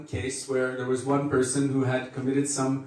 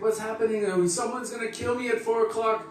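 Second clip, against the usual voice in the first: mock desperate begging; 8 semitones higher than usual. Desperate man begging in story.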